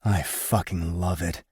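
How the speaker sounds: ordinary delivery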